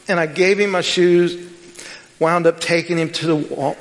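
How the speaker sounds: ordinary talk, nothing marked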